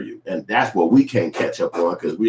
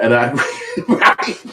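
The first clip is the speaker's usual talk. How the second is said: ordinary delivery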